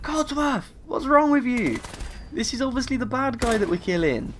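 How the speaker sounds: high, squeaky mock outrage; exasperated protest. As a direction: whine at him like he's being absurd. Arguing with disapproving robot.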